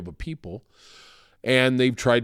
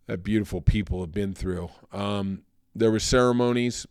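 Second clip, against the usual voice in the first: usual speaking voice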